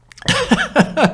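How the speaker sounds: high burst of real laughter; sudden high laugh. Real, not acted. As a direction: crack up helplessly. Reacts to viewer's news.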